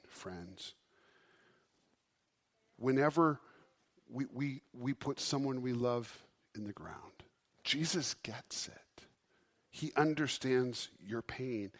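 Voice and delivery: normal talking voice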